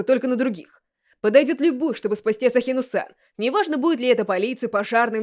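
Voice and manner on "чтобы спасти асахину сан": ordinary delivery, nothing marked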